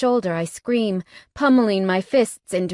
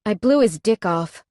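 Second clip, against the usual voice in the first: ordinary delivery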